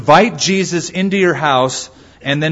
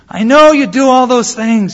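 higher and louder, insisting; pleading husband; 10 semitones higher, 7 dB louder than usual. Retold or imitated. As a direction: insist to the wife before softening. Husband answers the wife.

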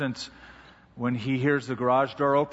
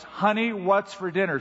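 higher, insistent call, firmly put; 5 semitones higher than usual. Acted. Husband right behind his wife asking about dinner, firm. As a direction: ask it firmly, pitched up. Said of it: "says in a firm voice".

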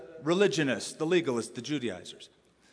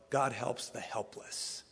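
whisper; 2 semitones lower, 8 dB quieter, slower than usual. Real, not acted. Hushed key truth of sermon.

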